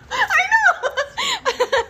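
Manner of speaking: very high, loud laughing; laughing agreement. Real, not acted. Agreeing with the audience, squealing with laughter. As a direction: giggle out the admission.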